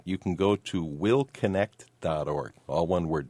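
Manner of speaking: ordinary delivery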